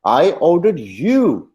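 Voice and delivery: "you" drawn out high, then falling; stress on YOU. Shown as a demonstration. Teacher shows who was ordered, stress on you. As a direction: slowly land hard on "you". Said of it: "मैंने तुम्हें कहा था".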